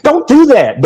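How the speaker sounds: high, whiny mock of the others. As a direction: sneer as you imitate them whining. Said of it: "what did he put in y'all's food".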